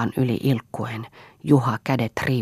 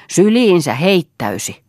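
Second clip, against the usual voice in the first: louder, higher, slow spiteful shout; growing rage; 6 semitones higher, 7 dB louder than usual. Acted. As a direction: spit each word out slowly in fury. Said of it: "yhä enemmän raivostuen".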